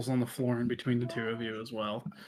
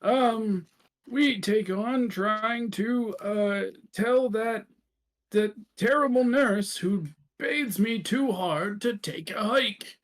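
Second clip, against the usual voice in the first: put-on higher-pitched, drawn-out old-timer voice; old folks' home resident; 9 semitones higher, 4 dB louder than usual. Old resident griping about a terrible nurse, high drawn-out character voice.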